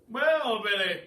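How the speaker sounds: put-on loud, high old gentleman; booming, jolly old duke; a cheery elderly duke greeting the boy; kindly Duke. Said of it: "he said".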